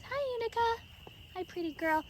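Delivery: high cooing hello to a pet. Real, not acted. Owner greeting her ewe Unica at the feeder, high coo.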